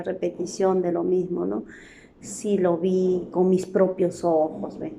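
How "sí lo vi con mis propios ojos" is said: slow, deliberate demo sentence; slowly spoken pleonasm example. Shown as a demonstration. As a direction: read it slowly to show the redundancy. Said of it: "¿Con qué lo vas a mirar?".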